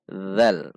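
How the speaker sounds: "dhal" dengan bunyi th lembut bersuara; makhraj dhal benar. Shown as a demonstration. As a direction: ucapkan "dhal" dengan ujung lidah di antara gigi. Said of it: "bukan zal, namun dhal". ("dhal" berbunyi dh, tanpa desis z)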